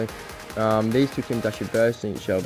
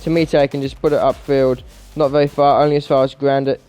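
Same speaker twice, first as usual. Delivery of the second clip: higher-pitched, animated match commentary; live play-by-play; 6 semitones higher, 6 dB louder than usual. Real, not acted. Gamer calling his team's comeback, live play-by-play. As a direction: call each chance and goal as it happens.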